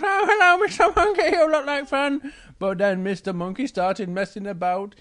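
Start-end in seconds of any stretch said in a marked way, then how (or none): 0.0-2.3 high, loud, excited cub; Little Bear. Acted. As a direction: greet a new pal in a high little bear voice. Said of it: "Little Bear goes".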